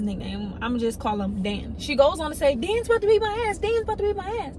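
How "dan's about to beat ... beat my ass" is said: put-on higher and fast, panicked girl; frightened girl. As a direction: rush it like a scared girl. Girl names who threatens.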